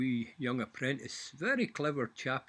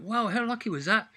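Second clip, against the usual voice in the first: higher, louder wide-eyed lad; amazed apprentice; 8 semitones higher, 7 dB louder than usual. Retold or imitated. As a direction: gasp it like an impressed young apprentice. Apprentice voiced in joke.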